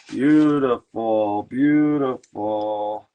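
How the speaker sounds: very slow, stretched-out appreciation; drawn-out admiration. Real, not acted. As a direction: savour each word, stretching the vowels. Admiring the paint he found.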